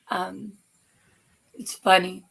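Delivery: ordinary talk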